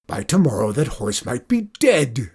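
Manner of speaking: mock scream in a farmer's voice. A narrator's reading. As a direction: burst out in hammed-up despair. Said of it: "screamed Zeke".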